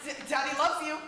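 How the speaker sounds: usual speaking voice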